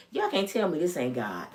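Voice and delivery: usual speaking voice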